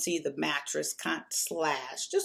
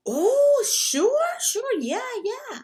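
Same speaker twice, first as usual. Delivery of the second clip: imitating a Mexican man's broken English, much higher voice; broken English Mexican accent; 5 dB louder, slower than usual. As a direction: put on the Mexican guy's accent, high and eager. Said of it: "he's talking to me in his broken English".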